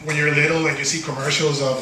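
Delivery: normal talking voice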